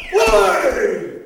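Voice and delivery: loud shouted command. Acted. Ciceronella yelling to Arlecchino to pull, loud. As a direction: yell it out.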